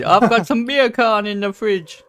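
put-on Jamaican accent. Jamaican pun reply.

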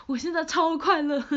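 giddy happy. Real, not acted.

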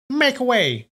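high, sharp command shout. Acted. Senior nun shoving the drunk aside, shouting. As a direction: order him out of the path.

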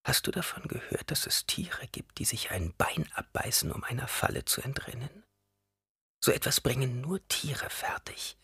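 put-on soft, whispery old woman's voice; testing old woman. A narrator's reading. Old woman tests the boy with a trap story, hushed and whispery.

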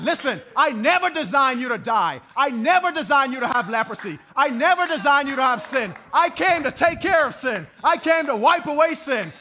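loud, high angry Jesus with NEVER hammered; preacher voicing Jesus' indignant shout. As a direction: shout it as Jesus, furious at sin. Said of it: "what Jesus was indignant about".

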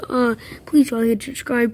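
ordinary talk, nothing marked

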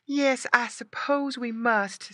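high, slow mother pig sigh; slow, wistful agreement; sighing resignation. A narrator's reading. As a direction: give in reluctantly as mama pig. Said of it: "with a sigh".

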